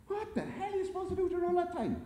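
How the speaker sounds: very high, exasperated squeal; exasperated wondering. A narrator's reading. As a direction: complain in a high, put-on voice. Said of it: "Jerry wondered aloud".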